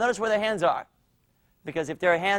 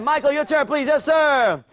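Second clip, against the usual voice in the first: high, loud summons; coach calling out; 8 semitones higher, 6 dB louder than usual. Real, not acted. Coach calling Michael for his turn, loud.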